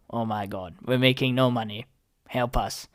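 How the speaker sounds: low, quiet, defeated murmur; hushed, deflated imitation of studio bosses; mock despair. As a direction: plead weakly, as if beaten.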